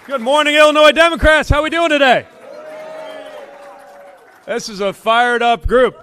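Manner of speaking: loud, high crowd greeting; podium speech. Real, not acted. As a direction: call out to the whole crowd.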